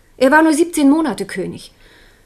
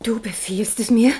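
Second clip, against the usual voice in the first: a hushed, disbelieving whisper; stunned whisper. Acted. Wife stunned by her husband's order, whispered.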